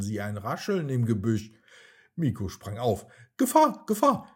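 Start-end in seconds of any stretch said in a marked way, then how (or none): 3.4-4.3 much higher and louder, agitated shout; monkey shrieking a warning at a rustle; excited alarm cry. A narrator's reading. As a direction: yell the alarm in panic. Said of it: "rief er aufgeregt".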